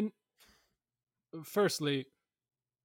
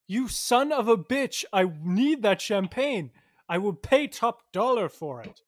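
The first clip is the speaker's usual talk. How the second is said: raised, emphatic outburst; angry demand; 3 semitones higher, 5 dB louder than usual. Acted. Customer demands champagne from the clerks, angry demand. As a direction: demand it loudly, at the end of your patience.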